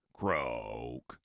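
a sleepy toad croaking goodnight; low croaky toad voice. A narrator's reading. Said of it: "he said".

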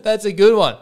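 high, laughing through the words; laughing bartender. Acted. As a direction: crack up while you talk. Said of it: "even he's in hysterics".

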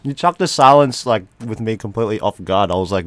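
talking with a mouthful; words round a bite of cookie; chewing while talking. Real, not acted. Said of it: "Sorry, I'm also eating a".

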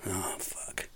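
unvoiced, defeated whisper; whispered curse. The boss giving up on keeping Abel, whispered curse. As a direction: let the swear slip out under the breath.

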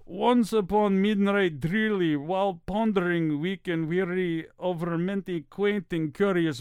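dramatic poem reading in a Russian accent; Russian character voice. Shown as a demonstration. Voice actor reading Edgar Allan Poe, Russian accent. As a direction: recite Poe in a put-on Russian accent, rising at the suspense. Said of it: "read something in that character voice".

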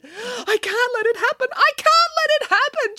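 very high, panicky repetition; frantic muttering. A narrator's reading. Nanny hiding under covers, high frantic panic. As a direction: squeal it in rising alarm. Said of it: "she muttered furtively".

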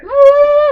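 very high, loud wail; mock panicked scream. Player sliding in the mud sequence, mock high scream. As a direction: let out an imitation scream of alarm.

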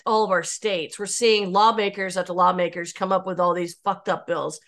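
ordinary delivery

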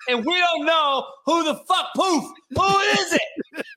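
high, squealing rant through tearful laughter; crying with laughter. Real, not acted. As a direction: wail it out, cracking up as you scold. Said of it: "I'm crying because I'm saddened".

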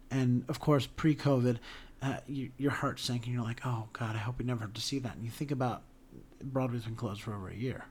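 soft, low, close to tearing up; getting teary-eyed. Real, not acted. Podcast host recalling Broadway dark and empty, teary-eyed. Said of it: "I'm just getting teary-eyed thinking about it".

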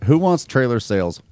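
normal talking voice

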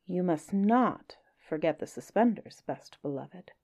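lively storybook reading with playful lilt; story narration. A narrator's reading. Reading a Kipling story to listeners, lively storybook voice. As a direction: read it like a bedtime tale to children. Reads Just So story aloud.

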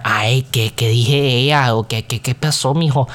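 lightly acted Spanish bickering; temperamental Mexican family. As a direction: imitate heated relatives fussing in Spanish. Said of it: "fights are a little bit more temperamental".

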